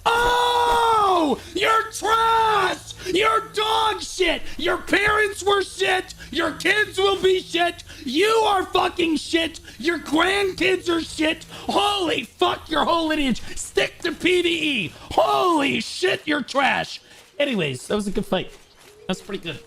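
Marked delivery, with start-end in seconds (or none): 0.0-11.5 gloating victory tirade; trash talk shout. Real, not acted. Trash talking defeated player. 11.5-17.0 gloating victory tirade; trash talk shout. Real, not acted. Trash talking defeated player.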